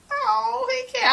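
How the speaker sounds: very high, breaking cry; mock swoon. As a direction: squeal it high, half crying. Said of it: "I was like".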